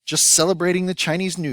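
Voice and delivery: high, loud cheery holler; shouted. Acted. Otis shouts to the diners amid the fireworks. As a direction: call it out gleefully over the chaos. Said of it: "Otis Amber shouted".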